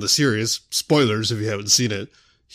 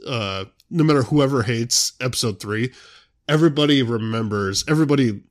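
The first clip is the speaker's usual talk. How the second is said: ordinary talk, nothing marked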